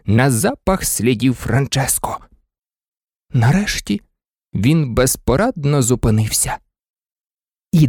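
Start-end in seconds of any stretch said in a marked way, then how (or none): none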